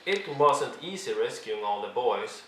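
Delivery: ordinary delivery, nothing marked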